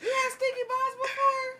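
slow, high, laughing disbelief; shocked laughing. Real, not acted. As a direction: squeal it out half laughing.

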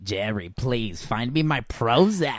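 put-on old man Harold. [name] as old Harold asking Jerry for pills, low slow old-man whine.

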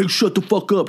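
high-pitched mock confrontation; hammed-up angry order; mock aggressive. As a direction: play out a street-fight challenge. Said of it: "just being aggressive and be like".

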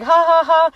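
high, loud fake ha-ha-ha; pushed-up mocking laughter. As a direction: imitate a gloating laugh in three beats. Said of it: "they're kinda laughing at this point".

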